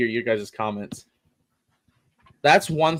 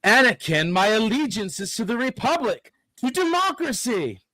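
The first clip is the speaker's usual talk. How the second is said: Obi-Wan impression; 8 semitones higher than usual. Obi-Wan line, high voice.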